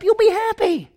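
squeaky high cheerful line; bright promise. Acted. Preacher promising the congregation happiness, high cheerful squeak. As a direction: lift it way up, almost cartoonish.

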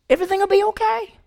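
high, quick sing-song reassurance; mock reassurance. God reassuring Cain, quick high sing-song. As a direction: toss it off lightly, way up high.

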